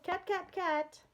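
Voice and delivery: high-pitched calling. A narrator's reading. Old man searching his house for a cat, calling out. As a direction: call out high for a lost cat. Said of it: "he called".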